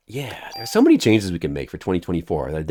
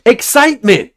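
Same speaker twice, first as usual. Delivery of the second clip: excited exclaim; 14 semitones higher, 7 dB louder than usual. Shown as a demonstration. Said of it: "narrate your feeling".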